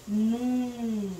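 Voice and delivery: "num" as one long nasal syllable; isolated syllable NUM. Shown as a demonstration. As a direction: hold "num" on a nasal hum. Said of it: "é a sílaba".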